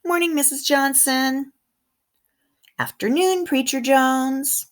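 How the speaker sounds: put-on cheerful child greeting. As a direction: call out friendly greetings to neighbours. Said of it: "And then I say".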